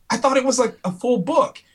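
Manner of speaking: high-pitched bewildered protest; baffled reader. Retold or imitated. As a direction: imitate a friend caught out and puzzled. Reader's sheepish retold excuse.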